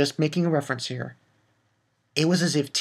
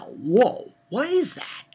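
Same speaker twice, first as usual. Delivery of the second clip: amazed; 8 semitones higher, slower than usual. Retold or imitated. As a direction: pitch jumps high on what is that. Amazed retelling.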